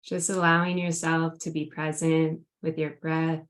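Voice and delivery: grounding meditation. Acted. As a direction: gently guide them into the present moment. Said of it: "would you lead us in a grounding meditation".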